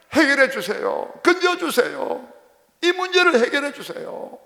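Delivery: high, urgent wail to God; crying out in prayer. Acted. Believer begging God over troubles and children, crying out. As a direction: cry out pleadingly, pitched well up. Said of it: "막 울어야 된단 말이에요".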